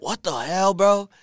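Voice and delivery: loud, high what the hell; astonished outburst; shocked. Retold or imitated. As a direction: blurt it out in disbelief. Reacting to [name]'s DM.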